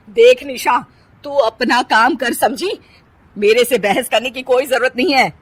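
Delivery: stern warning. Acted. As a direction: lay down the law. Usha warns Nisha. (धमकाती हुई सख़्त सास)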